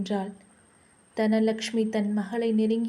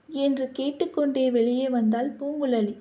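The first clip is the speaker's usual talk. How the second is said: usual speaking voice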